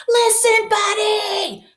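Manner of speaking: high, loud call; yelling. A narrator's reading. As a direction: yell his name to get his attention. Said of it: "His parents tried yelling".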